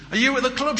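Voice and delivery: high, sweet wife on the phone; wife's voice. Acted. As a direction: lift the voice to play the wife. Wife's voice in the joke.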